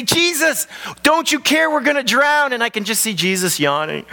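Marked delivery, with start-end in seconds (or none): 0.0-2.5 high, frantic cry over the storm; panicked disciples. Acted. Disciples crying to sleeping Jesus, high and frantic.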